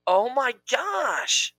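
drawn-out high oh my gosh; thrilled, stretch it out; excited gasp. Real, not acted. Excited about spiced tea.

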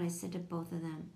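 usual speaking voice

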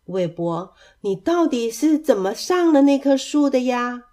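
ordinary delivery

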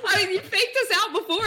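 laughing, high, caught off guard. Real, not acted. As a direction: laugh through the words. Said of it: "laughing at being surprised".

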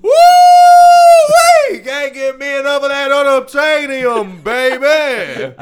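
high, loud whooping Texas cowboy. Pretend. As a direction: holler it like a rowdy cowboy. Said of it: "this is the cowboy who loves Avatar".

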